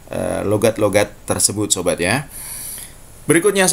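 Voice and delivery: normal talking voice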